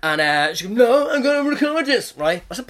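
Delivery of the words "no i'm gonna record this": high, loud whiny girl imitation; mock defiant girl. Girl refusing the comic's order, mocked as loud and defiant. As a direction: mimic a heckler refusing, pitched way up.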